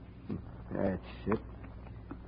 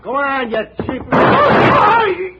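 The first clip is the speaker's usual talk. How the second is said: loud, higher, drawn-out yell; furious shout; 13 semitones higher, 10 dB louder, much slower than usual. Acted. Trench yells an insult at Andrea as she leaves. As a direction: bellow the insult after her.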